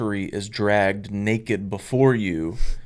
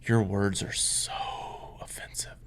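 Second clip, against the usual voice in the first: words dropping to a mock-shocked whisper; a fake-prim gasp of offense; mock horrified; 2 semitones lower, 9 dB quieter than usual. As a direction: clutch the pearls, then breathe the last word. Mocking the church's squeamishness.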